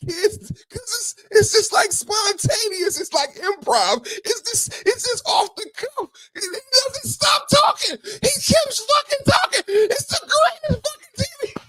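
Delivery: high, wailing fake sobs; hammed-up crying tribute; mock sobbing. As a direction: blubber it out like overcome with joy. Mock crying rant about host.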